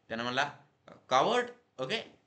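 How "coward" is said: high, loud insult; angry boy's shout. A narrator's reading. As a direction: shout the one word in anger. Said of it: "त्या मुलाला आता राग आला". (रागाने चढ्या आवाजात)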